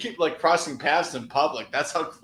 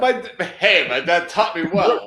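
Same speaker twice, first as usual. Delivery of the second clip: loud, higher-pitched comic delivery; stand-up comic impression; 5 semitones higher, 7 dB louder than usual. Showing the co-host how he presents stuff, loud comic impression. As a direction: punch it out as an old-school stand-up. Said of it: "I think I can do him a little bit".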